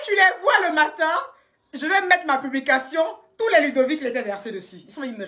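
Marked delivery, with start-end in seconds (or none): none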